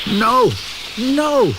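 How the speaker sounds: Billy cries out in horror; yelling. Acted. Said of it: "yelled Billy".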